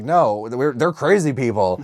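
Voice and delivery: usual speaking voice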